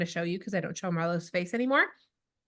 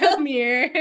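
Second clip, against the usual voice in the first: 1 laughing mock menace; 4 semitones higher, 6 dB louder than usual. Imitating kid grabbing.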